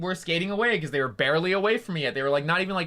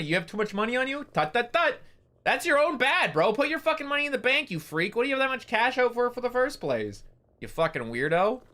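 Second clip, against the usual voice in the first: mocking taunt; 8 semitones higher than usual. Mocking robbery victims in roleplay.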